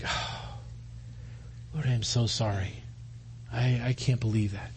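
very soft, low, hushed regret; remorseful prayer. Acted. Believer who failed facing God, hushed remorse.

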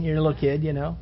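usual speaking voice

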